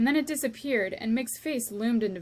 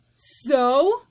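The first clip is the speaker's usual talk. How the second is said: loud, higher dismissive bark; shout; 4 semitones higher, 10 dB louder than usual. Acted. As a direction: snap it scornfully. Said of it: "shouted Cook".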